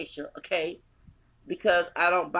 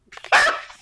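loud burst of laughter; 10 dB louder than usual. Real, not acted. Laughs at own mocking line.

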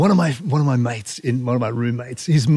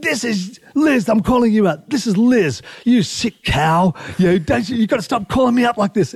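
calling out; 8 semitones higher than usual. Retold or imitated. Calling out his sister on hotline.

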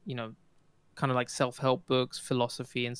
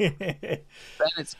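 laughing; 4 semitones higher than usual. Real, not acted. Laughing while reading chat.

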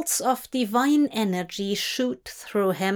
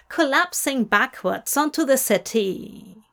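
ordinary delivery

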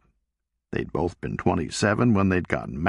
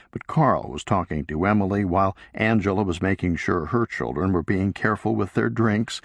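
plain reading voice

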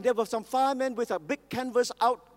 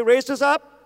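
louder, raised proclamation; call to repeat; 6 dB louder than usual. Real, not acted. Preacher leading the congregation, loud proclamation. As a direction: preach it out for the church to echo. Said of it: "Somebody say".

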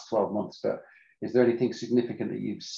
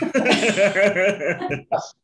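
laughing; 9 semitones higher, 6 dB louder than usual. Real, not acted. Laughing at the power tools joke.

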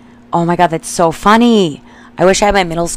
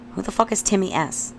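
usual speaking voice

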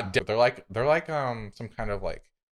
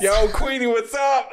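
loud, high, laughing hey; mock greeting; 12 semitones higher, 6 dB louder, slower than usual. Podcaster hollering a cheeky hello to the Queen, loud and laughing. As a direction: hail the Queen like an old buddy.

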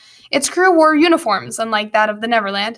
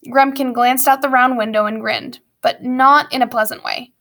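ordinary delivery

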